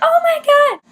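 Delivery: very high, loud fangirl shriek; thrilled squeal. Retold or imitated. Friends reacting as the singer waved at them, ecstatic. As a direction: squeal it like a starstruck fan.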